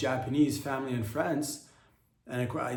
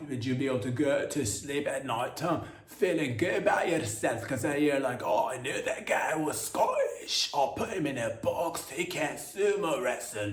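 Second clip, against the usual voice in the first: Scottish accent; 3 semitones higher than usual. Shown as a demonstration. Comic asking listeners if his supposedly natural voice suits them, exaggerated Scottish accent. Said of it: "Scottish accent the entire time".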